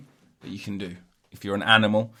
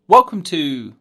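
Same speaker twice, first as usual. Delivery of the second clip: catchphrase intro; 7 semitones higher, 10 dB louder, slower than usual. Pretend. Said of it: "I've got, um".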